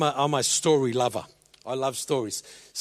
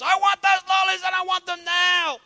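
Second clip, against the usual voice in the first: put-on much higher, louder screaming kid; child's shout; 4 dB louder than usual. Boy shouting at his father for lollies. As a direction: yell it like a spoilt child losing it. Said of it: "He raised his voice".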